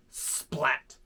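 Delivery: ordinary delivery, nothing marked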